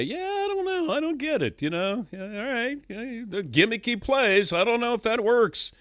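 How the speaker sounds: hammed-up shrugging Brady on air; mock Tom Brady. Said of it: "does he go out of his way to say".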